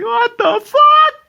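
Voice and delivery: high, slow, loud disbelief; incredulous high-pitched outburst; shocked. Real, not acted. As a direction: squeal it in disbelief. Incredulous reaction.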